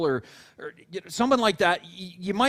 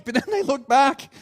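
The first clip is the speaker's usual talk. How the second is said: ordinary talk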